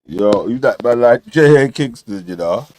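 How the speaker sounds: usual speaking voice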